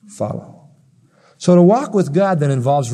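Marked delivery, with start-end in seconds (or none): 0.1-0.7 whisper. Acted. Echoing the call softly.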